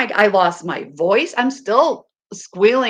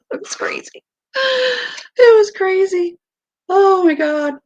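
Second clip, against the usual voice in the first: high, slow squeal of laughter; laughing squeal; 11 semitones higher, 3 dB louder than usual. Real, not acted. Overcome recalling the celebration.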